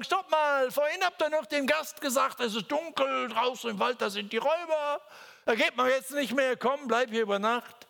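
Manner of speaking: high, loud, pleading to stop; calling after disciples to hold them back, high and loud; urgent warning. Retold or imitated. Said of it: "ich möchte sie festhalten und sagen".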